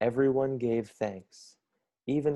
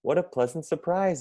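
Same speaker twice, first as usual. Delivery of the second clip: higher cheerful character voice; Vladimir greeting family; 7 semitones higher than usual. A narrator's reading. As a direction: greet the guests brightly, pitched up. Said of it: "he said".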